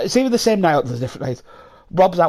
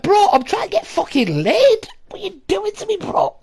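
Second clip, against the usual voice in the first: exasperated protest. Retold or imitated. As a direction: whine at your mate for ruining it. Retold protest at being blocked.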